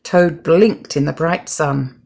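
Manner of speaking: ordinary delivery, nothing marked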